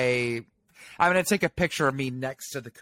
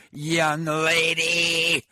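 slow, drawn-out singsong reprimand; mock scolding; 2 semitones higher, much slower than usual. Foodie tells off her friend over Bavel, mock scolding. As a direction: stretch it out like a teasing telling-off.

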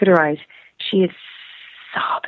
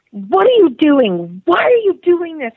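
imitated yelling; 9 semitones higher, 6 dB louder than usual. Woman berates a stranger at the coffee counter, yelling. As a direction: act out her loud, indignant yell. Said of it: "She starts yelling at me".

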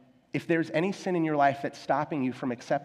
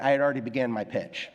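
usual speaking voice

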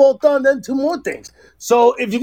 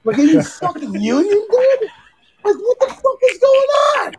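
high-pitched, exasperated yell; incredulous outburst; 9 semitones higher than usual. Retold or imitated. Husband to helpers caught on a break, incredulous yelling. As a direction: climb into a high, disbelieving shout.

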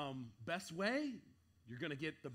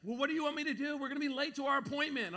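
higher, louder whiny wife voice; mock impatient wife; 10 semitones higher, 6 dB louder, faster than usual. Wife complains they will be late, mock whiny higher voice. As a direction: play the exasperated wife. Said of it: "She's like".

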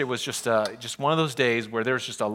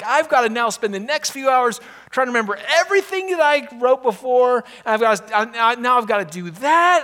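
higher, louder grumbling frustration; getting angry; 8 semitones higher, 7 dB louder than usual. Retold or imitated. Pastor retelling his fuming over rewriting the sermon, exasperated. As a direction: complain bitterly about the wasted hours. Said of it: "I'm just getting angry".

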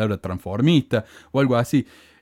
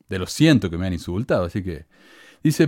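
normal talking voice

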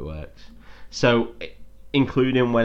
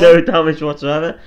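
mock disbelief; 6 semitones higher, 12 dB louder than usual. Real, not acted. Mocking incredulity at plot hole.

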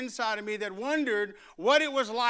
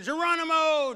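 higher, louder Geronimo shout; jump yell; 7 semitones higher, 7 dB louder than usual. Retold or imitated. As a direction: kids shouting as they jump. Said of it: "they would yell something".

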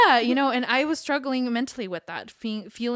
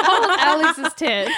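loud, high laughing joke; 8 semitones higher, 12 dB louder, slower than usual. Real, not acted. Friends teasing during a tearful chat, laughing loudly. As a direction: blurt it out through laughter.

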